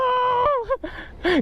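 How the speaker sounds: shrieking laugh, high. Real, not acted. Wild laugh as car goes.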